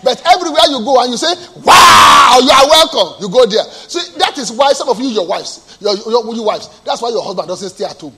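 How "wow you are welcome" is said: loud, delighted greeting; effusive welcome. Acted. Friendly host to the arriving visitor, loud and effusive. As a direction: burst out welcoming a favourite guest. Acted enthusiastic welcome to guest.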